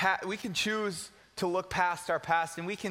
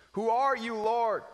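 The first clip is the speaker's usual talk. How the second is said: Saul asking; 4 semitones higher, 3 dB louder, slower than usual. Acted. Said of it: "Saul asked".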